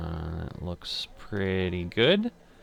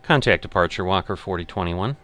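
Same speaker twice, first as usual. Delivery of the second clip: pilot radio readback; 6 dB louder, faster than usual. Acted.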